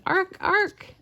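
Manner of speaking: high squawked ark ark; jay call imitation. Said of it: "I'm not very good at bird sounds".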